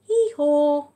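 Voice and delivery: loud high hee-haw bray; donkey bray. Acted. Mum braying the donkey's hee-haw for her son, loud and high.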